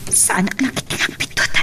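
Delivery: fearful whisper. Acted. A worker alone at night, whispering in fear. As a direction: whisper it, scared of the dark.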